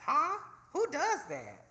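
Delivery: mock disbelief. Retelling her disbelief at the mechanic.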